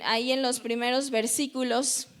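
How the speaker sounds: usual speaking voice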